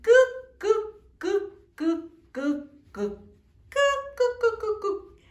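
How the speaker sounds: high hen clucks on "cu"; clucking hen. Shown as a demonstration. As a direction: cluck like a hen, flapping your wings. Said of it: "decimos el sonido de nuestra amiguita invitada". (cloquea como gallina, "cu, cu")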